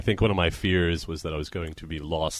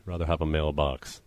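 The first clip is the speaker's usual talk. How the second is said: usual speaking voice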